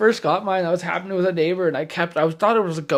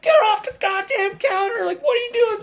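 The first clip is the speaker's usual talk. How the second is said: higher hammed-up telling-off; mock scolding; 14 semitones higher than usual. Pranksters yelling through the speaker at the wives, higher mock scolding. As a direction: scold someone on the counter. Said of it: "We're like".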